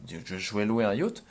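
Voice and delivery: ordinary talk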